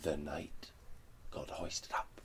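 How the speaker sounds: usual speaking voice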